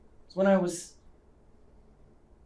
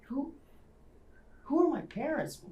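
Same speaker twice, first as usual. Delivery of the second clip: pleading; 8 semitones higher, 7 dB quieter than usual. Acted. Desperate question about lost past.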